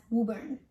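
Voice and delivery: Woburn as WOO-burn; oo sound in the first syllable of Woburn; local pronunciation. Shown as a demonstration. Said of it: "It's pronounced as".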